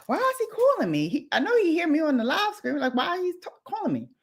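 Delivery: high, rushed baffled question. Retold or imitated. Wondering why the man phones during a livestream, baffled. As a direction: retell your own bewilderment, high and hurried, falling at the end. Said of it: "And so I'm like".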